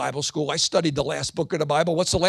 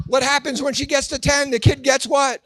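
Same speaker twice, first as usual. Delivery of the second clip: usual speaking voice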